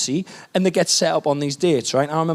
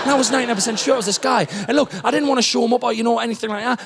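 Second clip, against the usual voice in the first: ordinary talk, nothing marked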